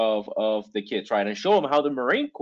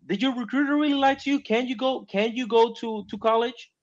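much higher, fast incredulous questioning; mock disbelief; 7 semitones higher than usual. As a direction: sound amazed at the excuse. Incredulous retort to complaining recruits.

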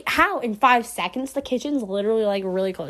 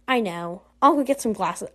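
usual speaking voice

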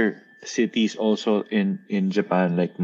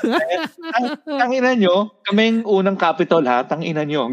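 indignant; 7 semitones higher than usual. Retold or imitated. Voicing the indignant Kyoto folk.

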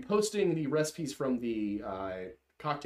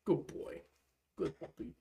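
soft praise; 5 semitones higher, 7 dB quieter than usual. Real, not acted. Praising the dog softly.

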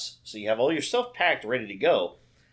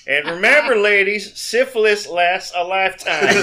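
loud and high, slow bark; drill sergeant; 7 semitones higher, 9 dB louder than usual. Pretend. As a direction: lecture the recruits loudly. Drill sergeant quotes continue.

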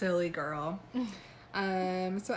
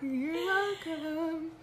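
mock grand; 9 semitones higher, much slower than usual. Joking sign-off.

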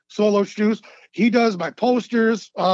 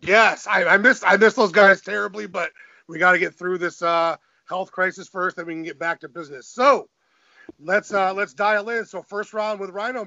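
usual speaking voice

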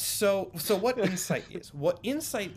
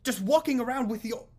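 much higher, put-on irritated shopkeeper voice; a rattled merchant demanding answers; angry shopkeeper; 8 semitones higher than usual. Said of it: "He goes".

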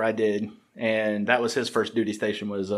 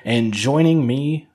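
lively, louder show-host welcome; podcast intro; 2 semitones higher, 5 dB louder than usual. Acted. Host introducing a guest to listeners, upbeat announcer delivery. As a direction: punch up the episode introduction.

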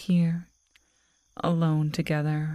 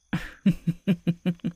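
longer wicked laugh; predatory laugh. Acted. Werewolf girl promising a chase, longer wicked laugh. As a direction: laugh like the hunt is a game.

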